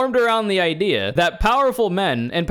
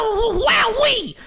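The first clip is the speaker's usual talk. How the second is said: high mock laugh. Real, not acted. High mocking exclamation.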